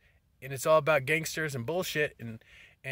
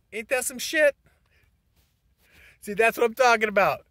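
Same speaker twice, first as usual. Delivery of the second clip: exasperated outburst; 8 semitones higher, 8 dB louder than usual. Real, not acted. Phone call interrupts his video.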